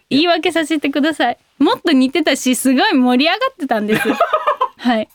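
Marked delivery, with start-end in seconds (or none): 4.1-4.7 embarrassed high laugh. Real, not acted. Embarrassed after impression.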